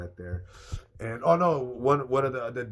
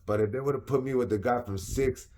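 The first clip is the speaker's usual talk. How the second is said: usual speaking voice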